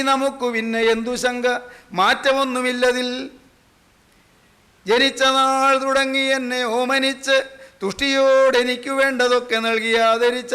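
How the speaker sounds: slow intoned verse on a sustained pitch; verse recitation. Acted. Preacher reciting a farewell to the world, slow chant-like declaiming. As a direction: declaim the poem with long-held syllables.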